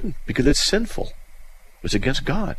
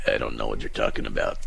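Frank in a low, dull, clueless voice; Frank, much lower; 13 semitones lower, 7 dB quieter, faster than usual. Retold or imitated. A clueless partner answering in a low, soft, dull voice. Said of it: "Bob and Frank".

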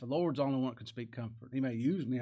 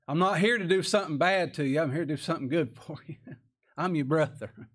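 louder, rising preacher's cry; Joseph's reassurance preached with fire; fervent preaching; 4 semitones higher, 6 dB louder than usual. Acted. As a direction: proclaim it with joy to the brothers. Joseph reveals himself, preaching.

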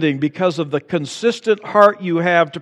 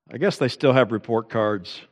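ordinary talk

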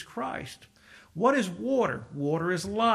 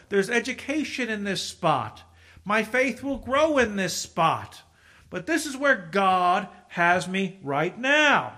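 louder, slower preacher's drive; emphatic sermon build; preaching; 5 dB louder than usual. Acted. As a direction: press each phrase home from the pulpit.

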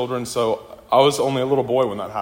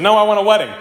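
protesting sister; 9 semitones higher, 7 dB louder, much faster than usual. Retold or imitated. Sister objects to eloping.

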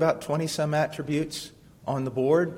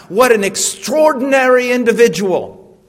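loud, higher, awed exclamation; mock admiring gasp; 6 semitones higher, 10 dB louder than usual. Preacher mimics listeners admiring a famous saint, awed. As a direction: gush over them like a starstruck fan. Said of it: "what is the first thing that comes into your mind".

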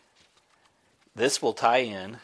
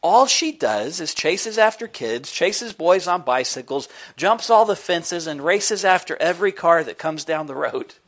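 higher, louder, exasperated complaint; high, loud exasperated woman; dismayed lady; 7 semitones higher, 8 dB louder than usual. Acted. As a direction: act out the owner throwing up her arms. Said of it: "threw up her arms in dismay".

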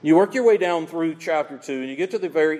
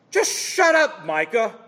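sharp high scornful hush; mock scornful order; 4 semitones higher, 4 dB louder than usual. Preacher mocking the opponents who silence Micah, scornful.

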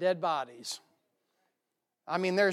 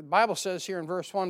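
usual speaking voice

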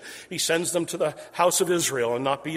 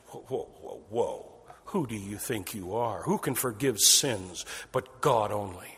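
low, soft, scoffing whoa whoa; indignant objectors; 6 semitones lower, 4 dB quieter than usual. Acted. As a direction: push back as a scandalized onlooker.

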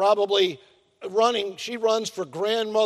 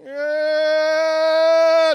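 put-on high, louder baby cry starting; baby fuss; 7 semitones higher, 5 dB louder than usual. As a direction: let out a baby's fussy cry. Imitating baby fussing.

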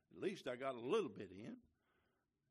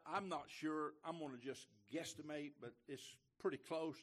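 normal talking voice

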